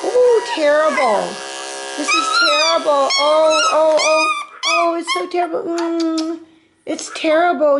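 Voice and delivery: high, cooing mock lament; mock sympathy. Groomer soothing a puppy that hates clippers, mock sympathy. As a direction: commiserate with hammed-up pity in a high voice.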